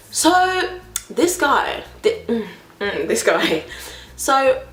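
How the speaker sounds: ordinary talk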